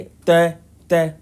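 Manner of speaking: तै said with the tongue touching the teeth; dental sound demo. Shown as a demonstration. Teacher showing how the tongue meets the teeth, a crisp demo. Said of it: "आपका जीभ जो है वो दांत को touch करेगी".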